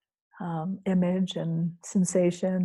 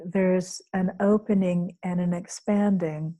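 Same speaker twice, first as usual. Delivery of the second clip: ordinary delivery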